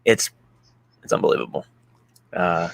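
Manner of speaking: ordinary talk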